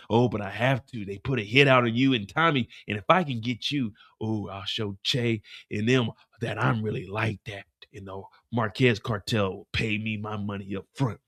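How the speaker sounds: low cocky thug voice; smug and low like a hired gun; a hint of a gangster bragging; lightly voiced hitman. Hitman explaining the contract.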